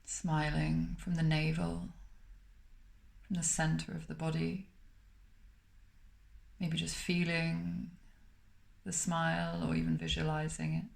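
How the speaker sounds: calm, unhurried meditation voice with long pauses between phrases; guided meditation. Acted. Meditation teacher guiding listeners to smile through the body, calm and spacious.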